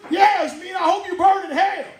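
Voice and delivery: high, cheery curse; lightly voiced Samuel Jackson, cheerful; mock Samuel Jackson. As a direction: bless and damn in one breath. Acted Samuel Jackson reply.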